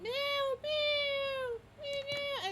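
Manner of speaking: high put-on kitten meows; squeaky cat meow. A puppy-cat mewing at the owners, high meows. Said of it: "You're like".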